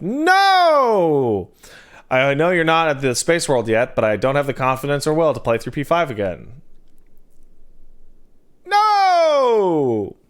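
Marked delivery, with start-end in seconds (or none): 0.0-1.5 emphatic no. Acted. Answering chat question emphatically. 8.7-10.2 emphatic no. Acted. Repeats the answer.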